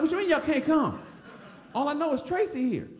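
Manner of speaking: high-pitched retold protest; mock indignant. Boy on the phone to absent cousins, mock indignant.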